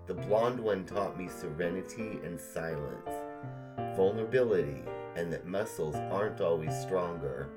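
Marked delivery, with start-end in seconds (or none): none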